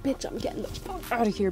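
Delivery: hushed whisper of relief; whispered thought. Retold or imitated. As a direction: whisper it under your breath as you leave. Whispered inner thought leaving work.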